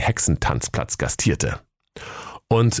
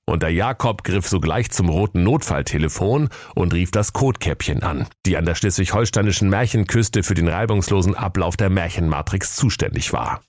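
plain reading voice

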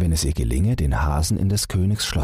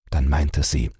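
usual speaking voice

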